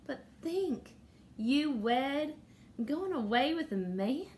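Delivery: dreamy awe at a sister's wedding; starry-eyed. Acted. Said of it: "Adelaide was starry-eyed".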